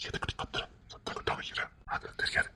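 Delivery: urgent whisper to hush her. Acted. Hushing her so family won't hear.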